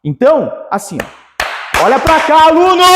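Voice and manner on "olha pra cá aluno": calling out. Real, not acted. Teacher demanding the student look here, high call. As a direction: yell for the student's attention, pitch soaring.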